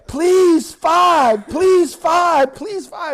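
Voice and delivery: loud, very high-pitched begging outburst; hammed-up wail of a drunk suspect; frantic drunk pleading. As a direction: blurt out pleas over and over, high and loud. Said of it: "he just breaks into this".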